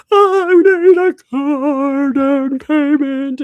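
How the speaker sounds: high, loud, drawn-out mock wail; exaggerated crying plea, stretched out. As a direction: wail it slowly like a hammed-up cry. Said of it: "they were crying for a car".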